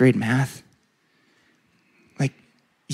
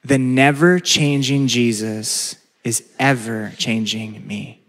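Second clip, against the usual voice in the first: slow, measured for the congregation to repeat; slow call and response; 2 semitones lower, slower than usual. Shown as a demonstration. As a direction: lead the room word by word. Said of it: "Would you say that with me?".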